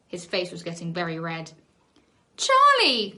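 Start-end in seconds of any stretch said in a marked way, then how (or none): 2.4-3.1 high shocked cry of his name; outraged cry. A narrator's reading. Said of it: "cried Sharon".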